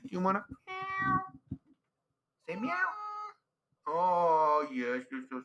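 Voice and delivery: talking to the cat in a silly high voice. Real, not acted. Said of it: "I talk like that 'cause it annoys my girlfriend".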